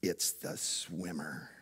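put-on hushed, low, slow kid's whisper to his dad; a small boy nudging his dad, barely audible; child voice. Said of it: "he goes".

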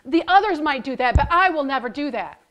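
loud, eager protest; loud indignant promise; blurting out. Acted. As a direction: blurt it out, sure of yourself. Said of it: "he blurted right out".

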